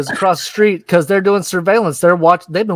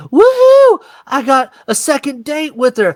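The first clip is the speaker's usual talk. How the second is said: high whooped celebration; giddy mock elation, far above usual pitch; hammed-up excitement; 9 semitones higher than usual. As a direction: squeal a whoop, then stay high. Acted teen celebrating second date.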